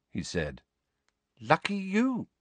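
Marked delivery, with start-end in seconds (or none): 1.4-2.3 high-pitched cool retort. A narrator's reading. Ginny responds to Harry's forgetting, cool. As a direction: answer coolly, unimpressed. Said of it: "said Ginny coolly".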